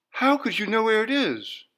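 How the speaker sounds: high, pleading question; wife bewildered by her husband. A narrator's reading. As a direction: ask in disbelief. Clarice voiced high.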